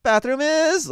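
mock actor with far too many choices; over-rehearsed actor. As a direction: bizarre overacted reading, pitched very high at the end. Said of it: "don't even talk like a human".

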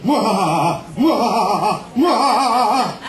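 villain's mwahaha; slow building evil laugh. Acted. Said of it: "Doctor Evil".

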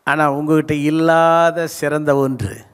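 ordinary delivery

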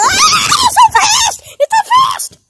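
very high, piercing slide scream. Acted. Kid going down a too-fast slide, screaming. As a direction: scream it all the way down.

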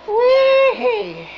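very high falsetto squeal; high, drawn-out silly whoop; playful whee. Pretend. Whooping during a jump.

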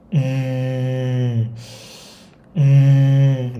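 a long hum after a deep breath. Shown as a demonstration. Teacher demonstrating a hum exercise, after a deep breath. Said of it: "hít một hơi thật sâu xong rồi bạn ừm ra".

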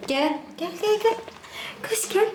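high, bright calling; calling the dog. Real, not acted. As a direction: call the dog over in a high voice.

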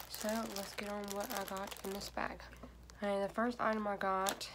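talking through face sheet; wearing a sheet mask. Real, not acted. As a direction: lips framed by mask hole. Seen 3: Printed sheet mask covers whole face; mouth hole open throughout.

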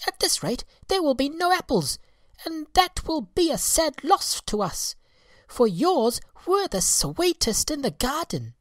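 very high twittering voice; little birds. Acted. Birds warning the apple fairy, very high and twittering. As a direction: chirp out the worry.

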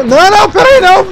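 high loud panicked no; panicked shout. Real, not acted. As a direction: yell no in sudden panic. Panics at sudden danger.

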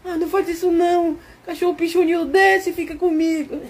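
high, laughing mock female plea; hammed-up girlfriend crying out to come down. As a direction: play the worried girlfriend begging him down. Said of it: "Ela fala".

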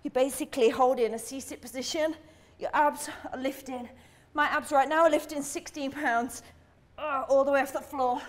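ordinary talk, nothing marked

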